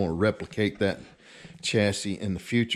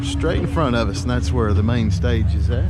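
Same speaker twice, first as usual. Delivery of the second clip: talking over the music; 2 semitones higher, 7 dB louder than usual. Real, not acted. Filming outside at a loud fair.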